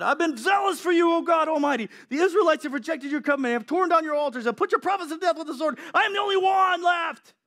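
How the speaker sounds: loud, anguished Elijah pleading with God; desperate shout. Acted. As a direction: shout it like a cornered prophet. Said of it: "He replied".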